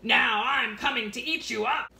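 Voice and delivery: troll. Acted. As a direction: growl it out as the troll.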